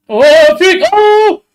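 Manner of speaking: high, loud cry of alarm; sudden shout, leaping up in pitch; startled yell. Real, not acted. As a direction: yelp as the ball nearly goes in. Startled by near goal in match.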